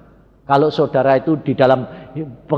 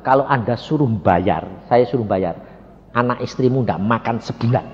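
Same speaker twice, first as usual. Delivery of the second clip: lower, measured reasoning with the driver; changed, calmed tone; 7 semitones lower, 3 dB quieter than usual. Retold or imitated. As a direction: re-enact a cooled-down, restrained scolding. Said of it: "nada saya sudah berubah". (nada rendah, sudah tidak marah)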